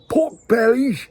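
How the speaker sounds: high, whispered mock American accent on "pork bellies"; a light stab at an American accent. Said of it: "I don't know if that's the right accent".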